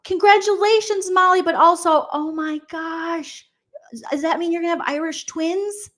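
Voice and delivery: high, stunned congratulations; high shocked disbelief. Real, not acted. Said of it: "Shock is".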